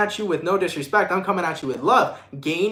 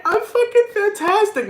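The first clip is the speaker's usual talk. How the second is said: much higher, fake-bright denial through tears; mock forced cheer; 4 dB louder than usual. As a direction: squeal it like you are not crying. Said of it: "you're like".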